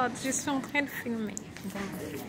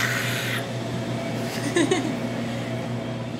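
breathy laugh; 5 semitones higher than usual. Real, not acted. Laughing at own song.

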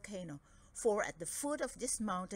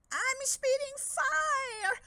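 very high, slow crying wail; wailing dragon; 7 dB louder, much slower than usual. Acted. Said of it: "wailed Dragodad".

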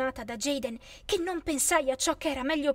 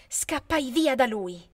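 ordinary delivery, nothing marked

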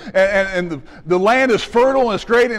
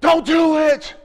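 higher mock yell; yelling at screen; 6 semitones higher, slower than usual. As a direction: call out at the screen. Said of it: "yelling at the screen".

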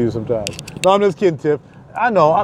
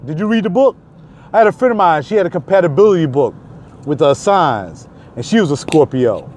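usual speaking voice

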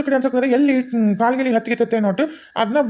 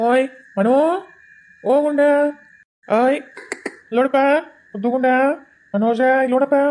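higher, sing-song calls to the infant; cooing at a baby; 4 semitones higher than usual. Acted. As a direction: coax the baby to look at you. Uncle coos at the baby.

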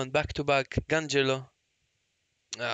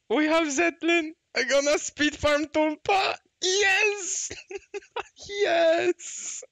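high, whooping celebration with laughter; jubilant; 10 semitones higher, slower than usual. Real, not acted. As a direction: celebrate the win at the top of the voice. Celebrating getting the wanted character.

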